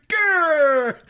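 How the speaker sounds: loud high angry growl noise. Retold or imitated. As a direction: snarl like a furious archer. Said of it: "however you imagine my face to look when I made that noise".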